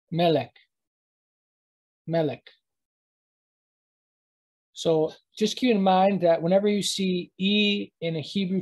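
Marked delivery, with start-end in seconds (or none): 0.1-2.5 "Melek" as MEH-lik, second vowel quick. Shown as a demonstration. Teacher repeating for learners, quick i-like second vowel. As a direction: make the second e in "Melek" short, almost an i. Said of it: "the second E is kind of quicker, almost like an I".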